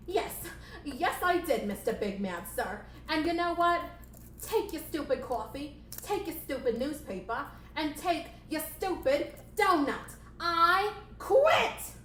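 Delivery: high, indignant puppet voice; fed-up assistant's hammed-up outburst; defiant quitting. Assistant rebels and quits.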